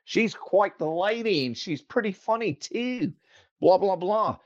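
high, chirpy put-on Australian; Australian accent. Guest recalling show banter, put-on Australian accent. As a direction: pitch up into a broad mock Aussie. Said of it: "I'll throw in an Australian accent".

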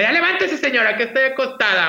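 high, bossy call to get up; teasing wake-up call. Retold or imitated. Granddaughter rousing grandma in the morning, high teasing call. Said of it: "en la mañana le decía".

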